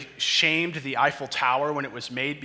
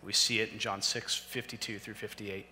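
ordinary delivery

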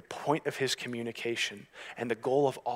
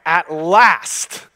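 sudden loud burst on the last word; emphatic exclamation; 6 semitones higher, 16 dB louder than usual. Real, not acted. Preacher announcing that the wise man finally speaks, emphatic exclamation. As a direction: exclaim it like a long-awaited arrival.

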